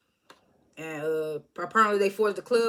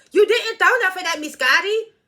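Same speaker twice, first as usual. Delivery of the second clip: high, sassy pipe-up; piping up; 9 semitones higher, 5 dB louder than usual. Retold or imitated. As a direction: butt in with sass. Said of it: "T started piping up".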